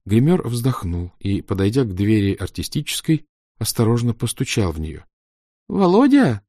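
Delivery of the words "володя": weak call. A narrator's reading. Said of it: "позвал он слабым голосом".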